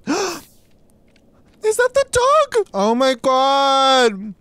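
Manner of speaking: high, slow, drawn-out squealed words; overjoyed excitement. Real, not acted. Player spotting the dog, overjoyed squeal. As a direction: squeal it loudly, stretching the words.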